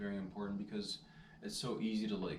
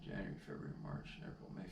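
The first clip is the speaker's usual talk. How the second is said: softer, whisper the months to yourself; whispered counting; 2 semitones lower, 7 dB quieter, faster than usual. Real, not acted. Vlogger working out the month, whispered counting. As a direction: count quietly to work it out.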